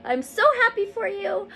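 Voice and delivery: high, overcome with emotion; emotional. Real, not acted. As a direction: voice lifted high by real emotion. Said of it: "I feel really emotional right now".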